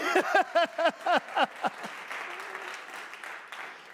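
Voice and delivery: high, burst-out laughter; hearty laugh. Real, not acted. Laughs at own story.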